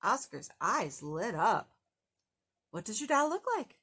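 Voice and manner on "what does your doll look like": quick, brisk grumpy question; lightly voiced grouch. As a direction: rattle it off like an eager grouch. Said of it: "said Oscar".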